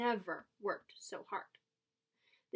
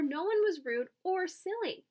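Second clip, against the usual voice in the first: ordinary delivery, nothing marked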